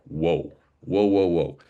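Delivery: half-joking whoa, pulling back; amused put-the-brakes whoa; mock alarm. Retold reaction to booking link.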